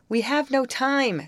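high, sharp refusal. A narrator's reading. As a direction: cut them off sharply. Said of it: "said Betty sharply".

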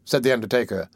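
ordinary delivery

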